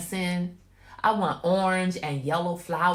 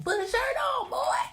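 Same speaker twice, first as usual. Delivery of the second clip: very high-pitched mock scolding; exasperated mom yell; 4 dB louder than usual. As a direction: holler it at your son, pitch shooting up. Mother scolds son off camera.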